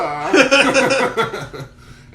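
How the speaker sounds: high loud burst of laughter. Real, not acted. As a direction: let out a big laugh. Laughing after the German line.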